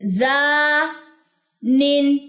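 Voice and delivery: زا held and flowing naturally into the empty mouth; flowing madd. Shown as a demonstration. Teacher models the natural flowing long aa. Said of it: "naturally فلو کریں اس کو".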